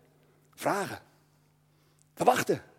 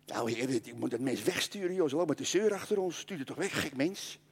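grumbling disciples; 6 semitones lower, 10 dB quieter than usual. Acted.

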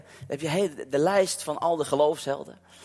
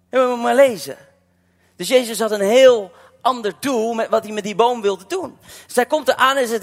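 raised, loud sermon delivery; full-force preaching; 5 semitones higher, 6 dB louder than usual. Acted. Preacher telling the fig tree story to the church, loud and raised. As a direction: drive the message home to the congregation.

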